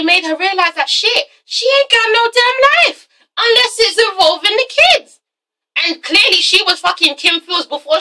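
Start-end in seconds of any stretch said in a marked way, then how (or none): 0.9-5.2 outraged rant. Real, not acted. Vlogger ranting to viewers about Kim's lost life. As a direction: pitch climbing to a high indignant peak.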